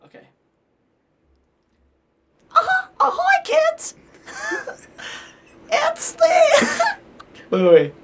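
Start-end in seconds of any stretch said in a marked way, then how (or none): 2.5-7.0 very high squeaky cartoon greeting; cartoon mouse voice. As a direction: call out to kids in a tiny mouse voice. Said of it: "let me try to get it a little bit lower".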